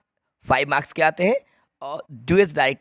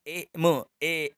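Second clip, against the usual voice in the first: drawn out very slowly, sound by sound; slow motion; 7 dB quieter, much slower than usual. Shown as a demonstration. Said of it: "slow motion में बोलो". (Slow motion में धीरे-धीरे)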